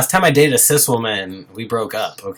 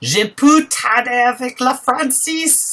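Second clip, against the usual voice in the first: lightly put-on French accent, higher; 8 semitones higher, slower than usual. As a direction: try out a French accent, playful. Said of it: "I'm not good at the accent".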